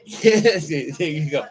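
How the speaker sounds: husband cheering his wife's leg presses, excited. Real, not acted. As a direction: cheer his wife through the exercise. Cheering wife doing leg presses.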